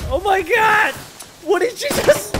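very high stunned yelling; shocked shout. Real, not acted. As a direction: shriek in disbelief. Reacting to teammate's blunder.

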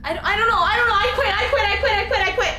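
high, loud panicked pleading; scared horror game streamer. Real, not acted.

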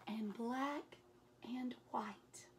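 drops to a whisper on the last colour. A narrator's reading. Reading Elmer's colours aloud, fading to a whisper. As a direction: let it fade to a breath.

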